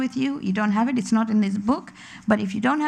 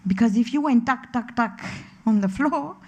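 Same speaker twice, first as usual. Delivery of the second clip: ordinary delivery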